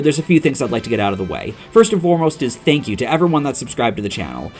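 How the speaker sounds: ordinary talk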